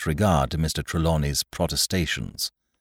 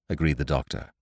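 plain reading voice

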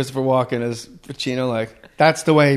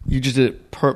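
ordinary talk, nothing marked

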